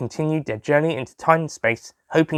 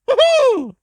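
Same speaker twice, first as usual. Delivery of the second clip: high laugh; 4 dB louder than usual. Acted. Doctor's chuckle.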